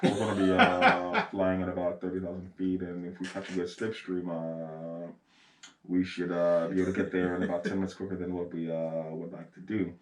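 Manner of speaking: low, soft airline pilot announcement. Shown as a demonstration. Showing how to fake an accent by talking like a pilot. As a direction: talk like a pilot on the intercom, hesitant. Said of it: "you have to talk like a pilot".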